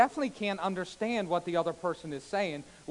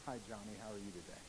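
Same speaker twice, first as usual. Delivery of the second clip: very soft, low table-side voice; low, hushed hello; close-range greeting; 8 semitones lower, 16 dB quieter, faster than usual. Shown as a demonstration. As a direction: speak quietly to someone right beside you. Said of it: "If Johnny was at the table".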